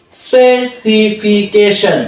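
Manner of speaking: Specification stretched slowly, syllables separate. Shown as a demonstration. Tutor to learners, slow broken-up specification. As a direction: draw out specification. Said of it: "তারপরে আমরা পড়বো".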